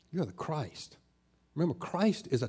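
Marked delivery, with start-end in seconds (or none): 0.1-1.0 very soft, quiet reply; softly spoken, reverent confession; hushed answer. Acted. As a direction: answer barely above a murmur. Acts out Peter's hushed answer.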